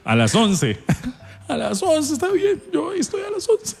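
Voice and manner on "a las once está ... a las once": very high mock-tearful employee; imitated whimpering agreement; Jessy. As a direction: squeak it out as if about to cry. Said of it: "Ya casi llora".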